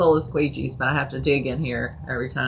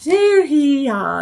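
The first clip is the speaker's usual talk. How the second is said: mock donkey bray; 9 semitones higher, 5 dB louder than usual. Donkey noise joke.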